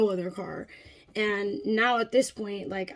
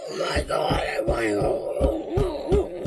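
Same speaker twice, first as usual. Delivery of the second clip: drawn-out mock wail, pitched up; freaking-out child; 6 semitones higher, much slower than usual. Child in a hot, shaking car, freaking out. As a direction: imitate a child wailing to leave. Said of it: "she's freaking out".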